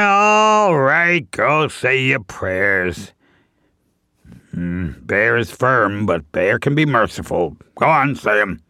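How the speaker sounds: low, grudgingly merciful bear; magnanimous bear. Acted. Bear allows the chipmunk his prayers, low and grudging. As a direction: grant it in a deep, self-important voice.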